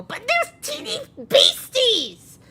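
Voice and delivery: put-on high, loud, protesting goblin child; Halamario. Child interrupts grandmother about the beasties, high loud wail. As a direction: wail it in a shrieky little voice, slowly. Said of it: "you hear Halamari go".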